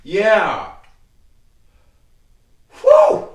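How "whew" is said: high, loud whooping "whew". Real, not acted. Host venting after the Ohio rant, loud high whoop. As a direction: let out one piercing, high whoo.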